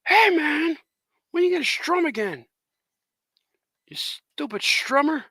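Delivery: high, pestering mock critic; heckling critic. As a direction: needle him in a put-on high voice. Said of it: "He said".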